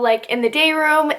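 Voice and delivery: ordinary talk, nothing marked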